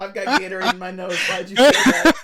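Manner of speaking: high, laughing protest; real helpless laughter. Real, not acted. Protesting after drink went up the nose, laughing. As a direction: complain through fits of laughter.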